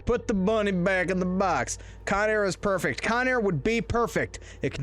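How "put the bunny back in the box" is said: mock movie-star Southern accent; Nicolas Cage's Southern accent. Mocking an action film line, bad Southern accent. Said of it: "if Nicolas Cage didn't have a southern accent".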